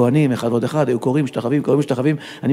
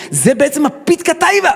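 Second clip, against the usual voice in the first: emphatic hype; 11 semitones higher, 4 dB louder than usual. Retold or imitated. Others hype yet another final seal.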